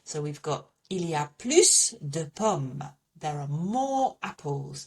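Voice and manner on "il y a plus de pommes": plus, final S sounded, plooss; French plus, S pronounced. Shown as a demonstration. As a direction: say plus de pommes with a clear S on plus. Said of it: "I'm gonna pronounce that S".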